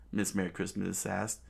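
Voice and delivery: plain reading voice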